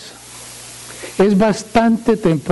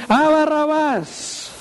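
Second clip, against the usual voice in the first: much higher, slow drawn-out crowd call; crowd's cry; 10 semitones higher, slower than usual. Acted. Preacher voicing the crowd choosing Barabbas, drawn-out cry. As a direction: voice the crowd calling out.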